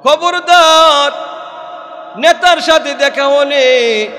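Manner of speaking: loud, high preacher's warning; warning shout. Acted. Preacher warns the crowd.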